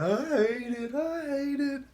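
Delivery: high, wailing mock sob of a homesick kid; half-acted teary wail; retold bawling. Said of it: "just bawling".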